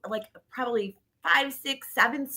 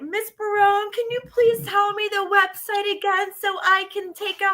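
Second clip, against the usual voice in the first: high, pleading little student; higher kid voice begging for the website; eager kid voice; 9 semitones higher than usual. Retold or imitated. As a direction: ask like an excited schoolkid. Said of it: "these elementary kids were like".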